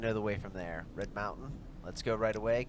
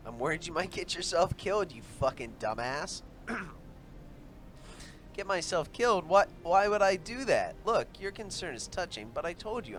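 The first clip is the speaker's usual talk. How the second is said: higher woman's voice, worried then indignant; companion's voice; 8 semitones higher, 4 dB louder than usual. Acted. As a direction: voice the companion, pitched up. Voices female companion Juliana.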